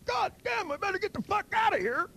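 very high, squealed alarm; hammed-up panicked inner voice, way up in pitch; mock panic. Inner voice on seeing a tiger under the bed, panicking.

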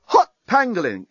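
high, sudden exclamation; startled exclamation. A narrator's reading. Journalist hearing the bomb count, high startled exclamation. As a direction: blurt it out in alarm. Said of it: "exclaimed the journalist".

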